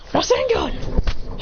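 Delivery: high, drawn-out move name; mock anime attack cry; attack call. Character calls out an attack.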